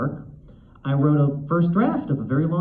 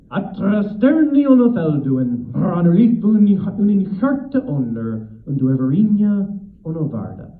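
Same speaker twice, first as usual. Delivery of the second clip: lilting Elvish accent; 4 semitones higher, 5 dB louder than usual. Shown as a demonstration. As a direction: intone an Elvish blessing with a lilt. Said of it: "there's a lilt to it".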